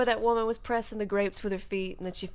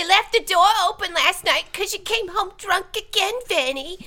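much higher, louder Jersey scolding; high Jersey wife; 10 semitones higher, 5 dB louder than usual. Retold or imitated. An imagined future wife scolding her husband in a Jersey accent. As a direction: nag like a New York wife. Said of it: "being like".

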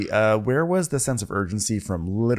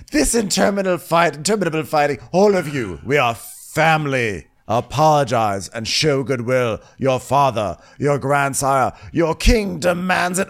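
loud, raised-pitch kingly tirade; mock royal scolding; 5 semitones higher, 5 dB louder than usual. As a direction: hammer out each order like an exasperated king. Acted king scolding his family.

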